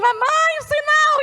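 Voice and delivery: high-pitched mock cry of alarm; imitated alarmed children. Daughters warning mother at the traffic light, high alarmed cry.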